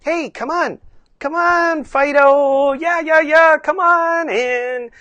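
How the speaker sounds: high, loud sing-out call; hammed-up excited calling to a dog; animated dog talk. Owner calling old dog Fido, animated silly voice. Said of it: "you are very animated".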